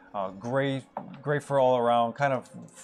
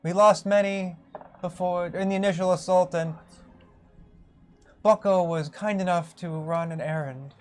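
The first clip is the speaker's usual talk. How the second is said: lightly higher doddery rabbit elder; a hint of the old rabbit; 5 semitones higher than usual. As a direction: keep the old rabbit voice, gentler.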